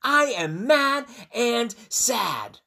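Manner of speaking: high, loud, slow declaration; emphatic acted storybook outburst; mad and sad. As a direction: announce the feelings word by word. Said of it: "now she needs Gerald to know it".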